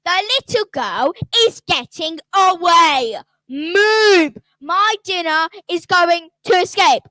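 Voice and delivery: raging witch shouting through the trees; furious witch. Acted. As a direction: snarl like a hungry witch losing her dinner. Witch furious at losing prey.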